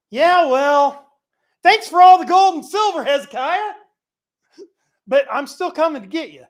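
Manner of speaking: high, loud, taunting enemy king; hammed-up arrogant king gloating over the gold. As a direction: crow it like a smug conqueror. Mocking Assyrian king's reply.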